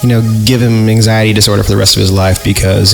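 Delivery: usual speaking voice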